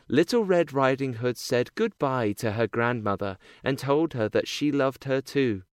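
ordinary delivery, nothing marked

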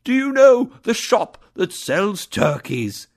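calling out. A narrator's reading. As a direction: call down to the boy. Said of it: "He called again to the boy".

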